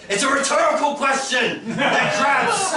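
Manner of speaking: high-pitched comic exasperation; mock-exasperated yell. Comedian to audience about crab accents, mock exasperated. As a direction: shout it as if the answer were obvious.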